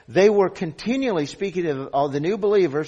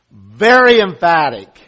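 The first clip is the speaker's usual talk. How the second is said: forceful emphasis; 10 dB louder than usual. Real, not acted. Preacher explains the Greek wording, loud and emphatic. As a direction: punch it out to the congregation.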